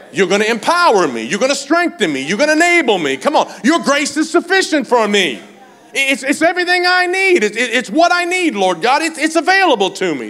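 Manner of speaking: shouted, high declaration of faith; full-force preaching. Acted. Believer declaring God's grace to the congregation, full-force preaching.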